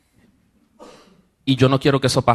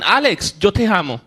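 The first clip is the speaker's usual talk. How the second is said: retold shout; 5 semitones higher than usual. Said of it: "y me gritan".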